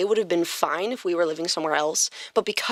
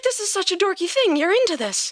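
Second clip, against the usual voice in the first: retelling her own reaction in a much higher pitch; high, hammed-up mock surprise; mock quoting herself; 11 semitones higher than usual. Telling her cohost how she reacted, high mock surprise. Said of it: "And I was like".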